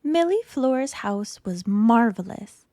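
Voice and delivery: ordinary delivery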